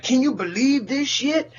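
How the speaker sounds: high mocking whine of an outraged person; mock outrage. Mocking people mad over nothing, a high whiny voice. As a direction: sneer at the people who get mad.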